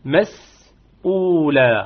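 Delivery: مسؤولة very slow with a short madd; short madd demo. Shown as a demonstration. Teacher models the rule on مسؤولة. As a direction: recite مسؤولة slowly, two beats. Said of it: "حركتين فقط".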